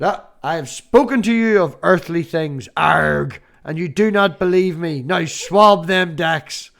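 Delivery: loud Irish pirate preaching; Irish pirate accent. Pretend. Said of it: "So said Pirate John".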